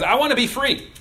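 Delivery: high, loud mock declaration; hammed-up longing for freedom; yearning fish. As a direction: proclaim it like a defiant little fish.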